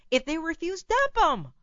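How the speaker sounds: fed-up outburst building to the end; real anger creeping into advice; getting angry. Real, not acted. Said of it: "I'm sorry, I'm getting angry".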